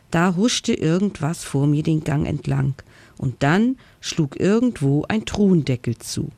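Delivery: ordinary delivery, nothing marked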